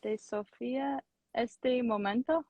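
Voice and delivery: slow, careful learner repetition; careful repeating. Shown as a demonstration. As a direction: sound out each word slowly. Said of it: "I ask everyone to repeat this phrase".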